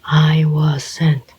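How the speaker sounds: slow, each word of "I was sent" pressed down evenly; deliberate, word-by-word emphasis; every word emphasized. A narrator's reading. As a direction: weigh every word, slowly. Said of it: "he emphasized every word".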